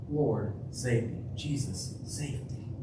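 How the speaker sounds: a quiet desperate whisper of a prayer; hushed desperate plea. Pretend. Voicing the sinking cry.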